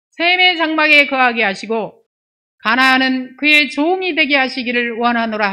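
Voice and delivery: solemn read-aloud Bible voice; scripture reading. Acted. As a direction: proclaim the verse, high and forceful.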